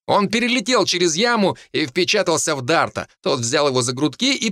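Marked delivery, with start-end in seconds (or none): none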